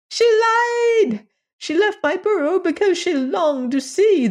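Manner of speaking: very high, anguished outcry; a tiny creature betrayed and pleading; wronged little alien. Acted. Alien bewailing deserting wife.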